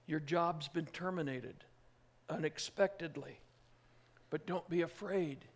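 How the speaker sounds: ordinary talk, nothing marked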